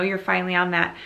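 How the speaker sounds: ordinary talk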